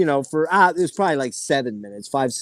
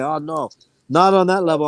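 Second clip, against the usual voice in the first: put-on French Canadian accent; 3 semitones higher than usual. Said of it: "That's my French Canadian".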